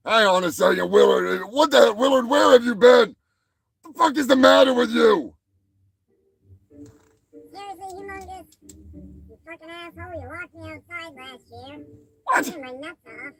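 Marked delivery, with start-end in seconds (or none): 1.5-5.3 higher, louder mock yell; hammed-up shouting at Willard; mock angry yell. Yelling at puppet Willard. 6.7-13.3 much higher, much softer squeaky puppet; high tiny puppet weasel complaining. Acted. Puppet Willard's voice.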